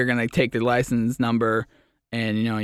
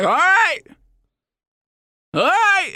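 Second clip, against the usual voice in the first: imitating a Boston guy, much higher and louder; hammed-up gruff Boston guy making peace; Boston driver settling the fight in a mock voice; 4 dB louder, slower than usual. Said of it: "shake hands and be like".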